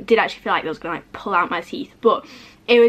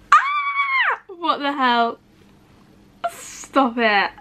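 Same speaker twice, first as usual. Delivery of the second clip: ordinary talk, nothing marked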